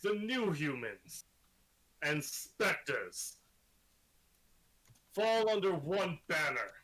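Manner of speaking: rising, booming proclamation, slowed on the list; grand villain speech. Acted. Villain proclaims his rule to the captive heroes, booming and grand. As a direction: declaim like a conquering overlord.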